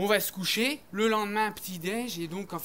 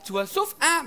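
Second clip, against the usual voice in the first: ordinary talk, nothing marked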